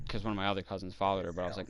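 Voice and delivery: ordinary delivery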